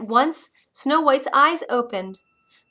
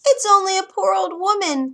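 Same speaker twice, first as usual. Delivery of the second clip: much higher, squeaky disguised voice; squeaky old woman; 10 semitones higher, 7 dB louder than usual. A narrator's reading. The disguised queen at the door, squeaky old woman. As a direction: squeak it like a frail crone. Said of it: "came a squeaky voice".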